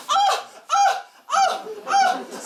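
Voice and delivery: high squealing oh oh oh; ecstatic high cries. Acted. Comic kitchen lover squealing in ecstasy.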